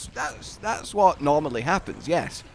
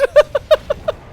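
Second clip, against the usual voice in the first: loud high-pitched laugh; high squealing laugh; 10 dB louder than usual. Real, not acted. Laughter after idea.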